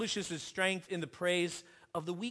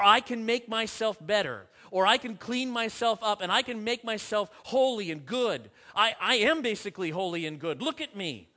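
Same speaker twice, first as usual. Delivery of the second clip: louder, smug bragging religious man; mock self-righteous boast; 4 semitones higher, 7 dB louder than usual. Preacher mimicking the religious man, smug and loud. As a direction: puff yourself up, "look at me". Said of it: "who wants to justify himself and say".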